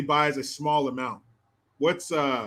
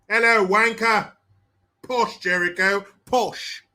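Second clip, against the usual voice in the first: higher, hammed-up British greeting; mock British accent; 3 semitones higher, 3 dB louder, slower than usual. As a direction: play a caricature Brit.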